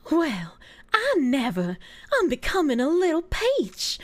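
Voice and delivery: slow, scandalised Southern drawl; hammed-up Georgia peach accent; Southern belle accent. Streamer assimilating with Georgia, mock Southern belle. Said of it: "every time I use that accent".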